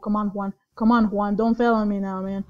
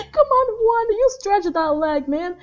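excited cheer; 8 semitones higher, 4 dB louder than usual. Real, not acted. Cheering on game character.

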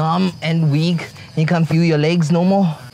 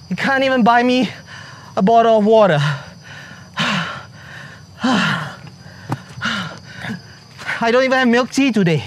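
exasperated complaint; 6 semitones higher than usual. Real, not acted. Complaining while climbing stairs.

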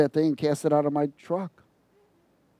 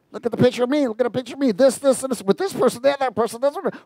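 mock selfie poster; 8 semitones higher, much faster than usual. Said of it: "Go to flesh book".

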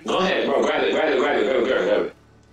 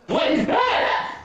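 1 startled cry; much slower than usual. Real, not acted. Sudden scary thing in game.

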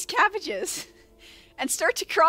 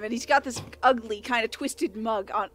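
normal talking voice

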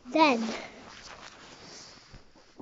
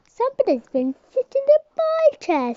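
much higher, loud squeal; high mummy bear; 12 semitones higher, 6 dB louder than usual. Acted. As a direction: squeal it out in outrage.

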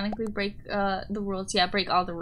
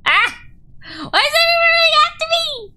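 high, loud panicked shriek; yelp, then rushed high-pitched panic; scared; 9 dB louder than usual. Real, not acted. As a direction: squeal it like you're being chased.

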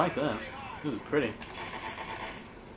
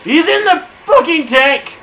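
loud, much higher furious shout; yell; 11 semitones higher, 12 dB louder than usual. Real, not acted. Gamer yells at teammates about the enemy tank, loud and high. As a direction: yell it out in real alarm.

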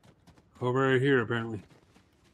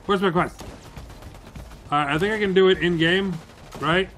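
ordinary delivery

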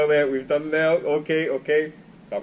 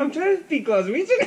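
high, loud Glaswegian protest through laughter; laughing protest; 4 dB louder than usual. Real, not acted. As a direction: squeal it in amused protest. Mock-indignant, laughing protest.

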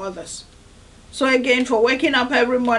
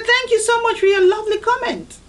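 mock sweet reply, higher; overly gracious thank-you; sweetly polite; 7 semitones higher than usual. Sweet reply to nasty online commenters. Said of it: "I say".

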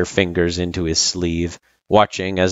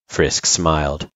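usual speaking voice

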